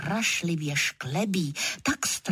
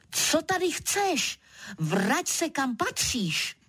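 mild story shout; 7 semitones higher than usual. A narrator's reading. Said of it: "a křičím".

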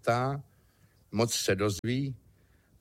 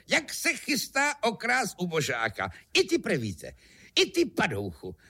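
high, indignant outburst at a thief; rozhořčené spílání; 10 semitones higher than usual. Acted. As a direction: scold the scoundrel in a raised pitch. Kubát vyjede na zloděje. (vysoko posazené, rozhořčené nadávání)

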